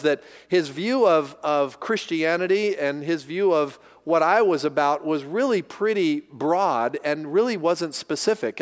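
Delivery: ordinary delivery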